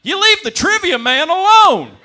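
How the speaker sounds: high, drawn-out bellow across a bar; defending regular's yell. Retold or imitated. Bar regular defending the trivia host, outraged slow yell. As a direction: holler it slowly at full volume. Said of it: "one of the regulars stands up and goes".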